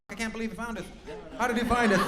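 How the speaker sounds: high-pitched mock disbelief; put-on high voice, playing discovered; mock dismayed audience member. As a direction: imitate a front-row guest caught out, pitched high. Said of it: "you're like".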